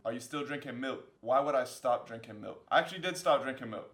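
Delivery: normal talking voice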